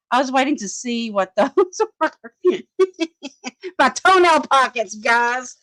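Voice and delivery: high-pitched, giggling through the words; laughing hard. Real, not acted. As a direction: tease your friends while cracking up. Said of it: "I'm laughing so hard".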